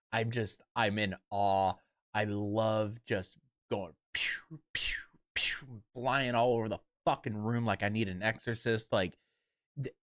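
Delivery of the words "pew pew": breathy whispered pew pew effect; whispered pew sounds. Pretend. Streamer miming flying round the room, whispered pew pew. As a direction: whisper the laser noises playfully.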